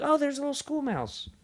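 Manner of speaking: very high-pitched mock coo. Woman spotting a mouse at school, cooing. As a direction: coo high, as at a cute little animal.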